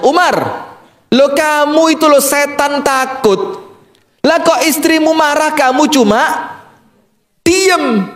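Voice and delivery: high, puzzled questioning; incredulous man. Acted. Husband asking Umar in high-pitched disbelief. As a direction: ask in disbelief.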